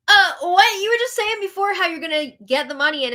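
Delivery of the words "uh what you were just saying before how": high, loud burst of disbelief; incredulous. Real, not acted. Host catching a contradiction, incredulous. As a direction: blurt it out, baffled.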